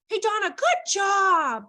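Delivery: high, slow sugary praise; fake praise voice. Shown as a demonstration. Teacher demonstrating praise that isn't authentic, sugary sing-song. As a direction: coo it in drawn-out motherese. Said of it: "here's another one".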